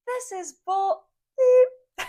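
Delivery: high, loud imitated outburst; mock angry yell. Retelling a bald customer's tantrum, mock yell. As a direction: ham up a furious customer.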